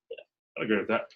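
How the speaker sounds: soft, offhand agreement; holding back. Shown as a demonstration. Recruiter demonstrating the reply interviewers should give, held back. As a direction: agree quietly, as if unimpressed. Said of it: "You're gonna say it like that".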